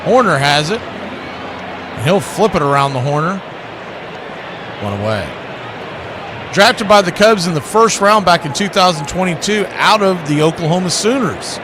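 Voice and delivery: play-by-play. Acted. Calling the game play-by-play.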